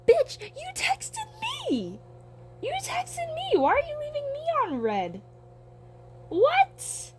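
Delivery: very high, indignant mock outrage; outraged high squeal. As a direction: squeal it in disbelief at being ignored. Outraged at being left on read.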